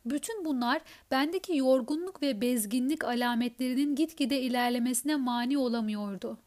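usual speaking voice

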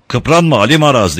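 loud, high angry shout. Acted. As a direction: bark the order at him. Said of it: "diye bağırdı".

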